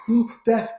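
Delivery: gasp, then a high, loud "oh, death"; mock horrified gasp. Mock shocked reaction to cancer.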